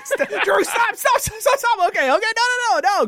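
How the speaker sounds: high panicky cries of stop; panicked yelling. Pretend. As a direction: yell like a scared passenger. Panicked passenger yelling at driver.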